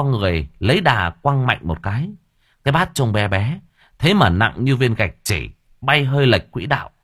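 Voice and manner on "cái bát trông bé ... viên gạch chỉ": ordinary delivery, nothing marked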